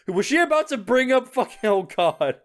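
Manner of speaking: usual speaking voice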